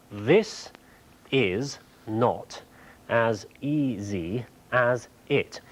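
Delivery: slow, measured, with long pauses between words. Shown as a demonstration. Presenter reading out a sentence being coded, slow and halting. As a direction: spell it out slowly, a few words at a time. Reads out sentence while coding it.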